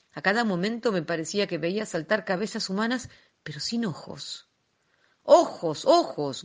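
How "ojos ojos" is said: loud, rasping cry of the eyes, falling away; a villain's hungry shout; hoarse shout. A narrator's reading. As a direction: bark both words out, the first loudest. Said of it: "gritó Coppelius con voz ronca".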